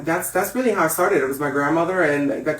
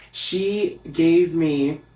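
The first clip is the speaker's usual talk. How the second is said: ordinary talk, nothing marked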